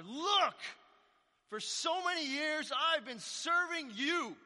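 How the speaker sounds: put-on older brother shouting at his father; angry. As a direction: blast it like a wronged son venting years of resentment. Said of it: "Oh, he's mad".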